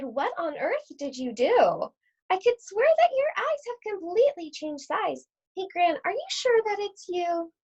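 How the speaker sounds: put-on a small girl squinting at her gran, puzzled; puzzled little Red. Red questions her granny.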